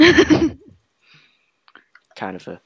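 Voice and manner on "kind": high squeal. Real, not acted. Sudden squeal.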